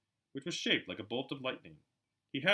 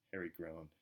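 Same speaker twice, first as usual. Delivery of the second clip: ordinary delivery, nothing marked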